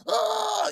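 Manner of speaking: sudden high yelp like tumbling down stairs; mock fall yelp. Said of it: "sound like Al Brooks, like, falling down the stairs".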